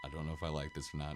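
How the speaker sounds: quick, hushed and uneasy; nervous hushed rush. Retold or imitated. To Sammy in the haunted dome, hushed nervous rush. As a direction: mutter fast, scared of being overheard.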